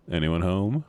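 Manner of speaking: ordinary delivery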